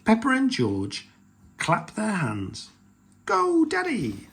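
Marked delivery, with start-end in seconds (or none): 3.3-4.3 put-on high, drawn-out children's cheer; long excited whoop. A narrator's reading. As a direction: cheer Dad on in a squeal. Children clap and cheer Daddy on.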